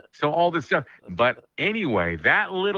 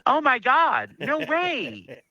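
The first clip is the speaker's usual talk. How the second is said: much higher, squealing disbelief; starstruck waitress; 13 semitones higher, slower than usual. Retold or imitated. Waitress meets the Wolfman's son, high starstruck squeal. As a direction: gush like a starstruck fan.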